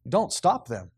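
ordinary talk

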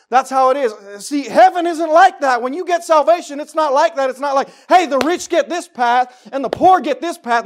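loud, high fervent sermon shout; full-force preaching. Acted. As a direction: drive the point home to the congregation.

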